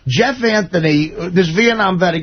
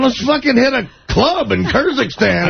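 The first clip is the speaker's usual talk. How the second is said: put-on drunk excited voice; 6 semitones higher than usual. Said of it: "These guys are drunk".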